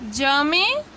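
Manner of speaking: usual speaking voice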